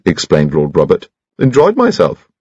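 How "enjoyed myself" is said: put-on very high, piping chatty old gentleman; cheerful little squeaky voice; high-pitched Bunchy. Old friend greets Troy at the gallery, high piping chatter.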